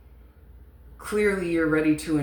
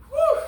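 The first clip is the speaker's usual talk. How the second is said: high, airy "whew" after welling up; emotional sigh of relief; 7 dB louder than usual. Real, not acted. Reader recovering after a rough emotional moment, shaky-relief sigh. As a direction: blow out the feeling on a long breath. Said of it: "that one was rough".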